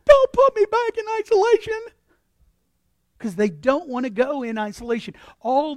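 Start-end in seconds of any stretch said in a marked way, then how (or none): 0.1-1.8 much higher, louder desperate plea; pleading tough guy. Retold or imitated. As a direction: beg like a scared inmate. Tough prisoner begging.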